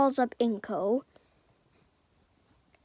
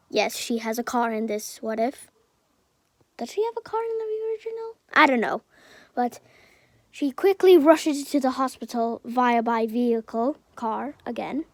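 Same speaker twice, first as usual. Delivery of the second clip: usual speaking voice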